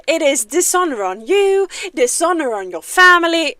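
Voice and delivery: loud, theatrical dragon proclamation; Mushu impersonation. Acted. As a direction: declare the dishonor with grand drama. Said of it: "Mushu's monologue from Disney".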